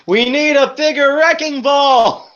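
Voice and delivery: very high, slow, loud exclaim; excited cartoon shout. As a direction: yell it like a cartoon engine. Quoting character exclaiming.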